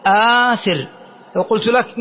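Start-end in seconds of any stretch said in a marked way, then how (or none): 0.1-0.9 آثر with a long open aa after the hamza; hamza plus alif. Shown as a demonstration. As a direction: draw out the first vowel of آثر without a break. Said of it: "همزة وألف".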